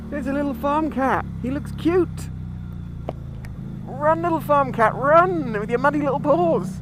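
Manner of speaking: high, sweet talk to a cat; cutesy voice. Real, not acted. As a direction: coo at the little cat. Talking sweetly to a farm cat.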